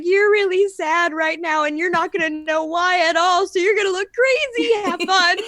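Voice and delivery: lightly voiced taunting brain; her brain's voice. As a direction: tease yourself as your own brain would. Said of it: "triggered my brain to be like".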